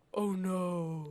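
deep wombat voice. Retold or imitated. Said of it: "in a really deep voice".

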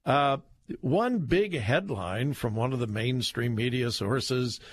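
ordinary talk

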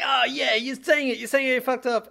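very high, loud mock gloating; hammed-up smug singsong; mocking smugness. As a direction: imitate someone smugly crowing over an apology. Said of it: "when you're smug".

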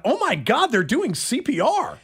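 alarmed exclamation, voice swooping high; retold scream. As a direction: relive crying out in alarm. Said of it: "And then I screamed out".

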